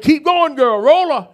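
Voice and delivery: loud, urgent command to the niece; urgent shout. Retold or imitated. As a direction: yell at the driver to keep going. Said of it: "I said".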